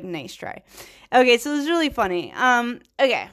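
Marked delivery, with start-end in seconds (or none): 0.0-0.6 much softer, dry and unimpressed; cold dismissal. Retold or imitated. Robot Dolores shuts down Charlotte's pitch, quiet and dry. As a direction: brush off the offer coolly. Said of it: "Dolores is like".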